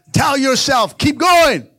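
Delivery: loud, high rallying shout; shouted exhortation. Real, not acted. Pastor rallying the church, loud high shout.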